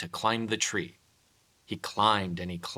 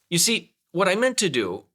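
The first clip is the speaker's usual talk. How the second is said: flustered explaining; 8 semitones higher, 8 dB louder than usual. Acted. Pooh explaining while falling.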